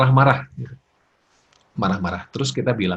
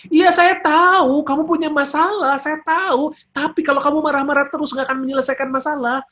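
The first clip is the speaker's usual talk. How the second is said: very high, raised-voice acknowledgement; matching angry intensity; 5 dB louder than usual. Shown as a demonstration. Friend matching an angry friend's pitch, high and forceful. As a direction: meet their anger at the same high pitch. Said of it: "Intinya adalah samakan frekuensinya".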